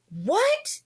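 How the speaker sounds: high, rising retold "what"; acted disbelief. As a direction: shoot the pitch up in disbelief. Retold shock on the video call.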